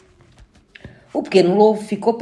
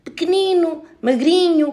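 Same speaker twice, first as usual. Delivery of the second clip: ordinary delivery, nothing marked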